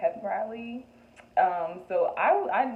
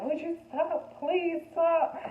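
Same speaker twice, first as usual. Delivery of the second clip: pleading; 11 semitones higher, 3 dB louder, much slower than usual. Retold or imitated. Tugging twerking boy's pants, pleading.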